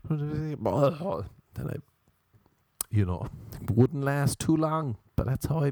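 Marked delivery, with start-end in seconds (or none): none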